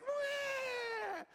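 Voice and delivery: long, high-pitched imitated cry; hammed-up shriek of toe-stubbing pain; mock child's wail. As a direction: let out a drawn-out kid's wail. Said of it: "And she's like".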